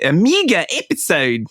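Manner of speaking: light New Zealand accent, mega as meega. Shown as a demonstration. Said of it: "Can you say that in a New Zealand ac-, accent?".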